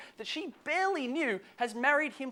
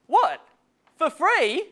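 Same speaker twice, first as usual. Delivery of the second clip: loud, high incredulous retort; hammed-up shocked question; mock disbelief; 5 semitones higher, 7 dB louder, much slower than usual. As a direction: act out being affronted by the offer. Acts out his indignant reaction.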